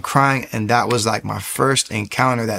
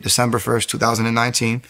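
normal talking voice

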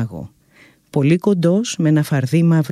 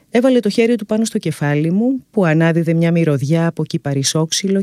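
plain reading voice

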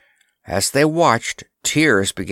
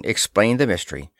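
ordinary delivery, nothing marked

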